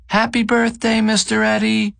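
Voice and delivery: high-pitched mock scream of children; imitated children's birthday shout. A narrator's reading. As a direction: pitch it way up like cheering kids, without much extra volume. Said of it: "a group of children scream in unison".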